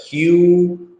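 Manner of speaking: ordinary talk, nothing marked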